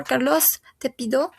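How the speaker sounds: ordinary talk, nothing marked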